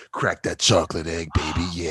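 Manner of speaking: sultry porn voice. Pretend. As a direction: purr it like a cheesy porno. Joking about chocolate egg porn.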